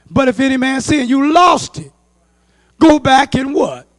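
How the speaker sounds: loud, high scripture exhortation; full-force preaching. Real, not acted. Preacher urging the fallen to go back, full-force preaching. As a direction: build loudly to a question for the congregation.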